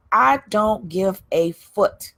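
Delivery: slow, drawn-out "I don't give a foot"; slow emphatic. Real, not acted. To viewers, slowly stressing that she doesn't care. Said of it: "I would normally say the F-bomb".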